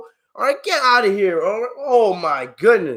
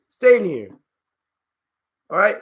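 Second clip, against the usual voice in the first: ordinary talk, nothing marked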